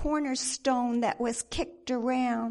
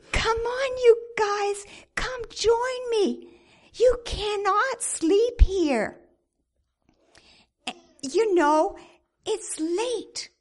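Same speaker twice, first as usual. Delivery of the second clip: pleading call; 7 semitones higher, 6 dB louder than usual. Acted.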